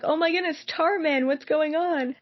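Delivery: high-pitched mock astonishment; retold surprise. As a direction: react in amazed disbelief, pitched well up. Retelling her surprised reaction.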